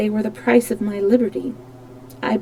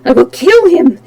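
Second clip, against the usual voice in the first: high, loud threat; furious; 5 semitones higher, 7 dB louder than usual. Acted. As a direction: spit out the vow to kill. Raoul vowing revenge.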